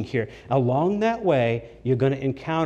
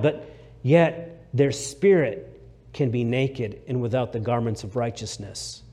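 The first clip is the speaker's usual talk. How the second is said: ordinary delivery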